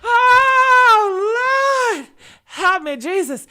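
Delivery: high, loud hammed-up wail; over-the-top mock sob to heaven; mock crying out to God. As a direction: cry out to the Lord in mock despair.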